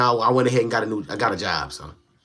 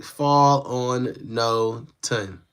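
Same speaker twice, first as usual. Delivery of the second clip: spelling out fall on no ton very slowly; sounding out slowly; 3 semitones lower, 5 dB quieter, much slower than usual. Shown as a demonstration. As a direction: fall on no ton, each part stretched very slowly. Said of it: "it says, like".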